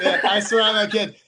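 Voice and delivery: laughing. Real, not acted. Laughing admission about swearing.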